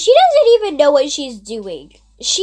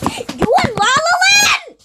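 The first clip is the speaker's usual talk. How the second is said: much higher shouted "La La Land"; exasperated shout. Retold or imitated. Fed up with the meowing friend, higher exasperated shout. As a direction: yell it at her in disbelief. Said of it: "So I'm like".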